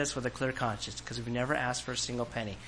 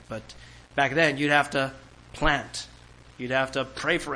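ordinary delivery

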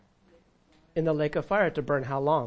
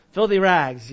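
louder, laughing through the words; laughing repeat; 7 dB louder than usual. Real, not acted. Preacher amused by the phrase, laughing and louder. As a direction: laugh as you say it again.

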